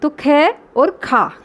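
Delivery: slowly, ख then खा with the long आ drawn out; contrasting ख and खा. Shown as a demonstration. Teacher to children, contrasting ख and खा slowly. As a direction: set ख against खा, stretching the आ. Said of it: "आ की मात्रा आ रही है".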